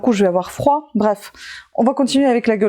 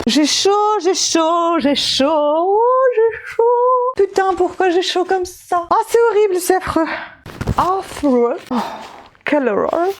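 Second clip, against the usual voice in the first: mock whining; 9 semitones higher than usual. Overheated vlogger hams up complaining about the heat, whining high. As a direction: drag out the complaint like an overheated diva. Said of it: "on arrête de déconner".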